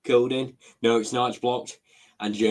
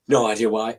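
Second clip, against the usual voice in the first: normal talking voice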